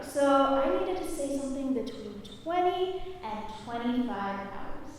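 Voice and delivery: ordinary talk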